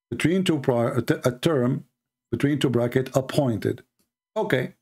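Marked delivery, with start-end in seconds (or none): none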